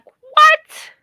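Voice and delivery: high, loud startled "What?"; shocked. Retold or imitated. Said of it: "I was like".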